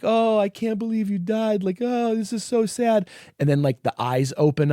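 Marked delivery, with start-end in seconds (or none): 0.0-3.1 higher, hammed-up weepy wail; imitating a movie hero sobbing over a friend; mock sobbing lament. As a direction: parody a crying hero, overdone. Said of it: "as the lead character is sobbing, being like".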